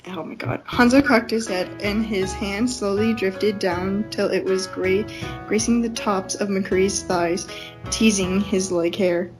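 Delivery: normal talking voice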